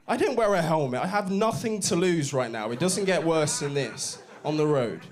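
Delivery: ordinary talk, nothing marked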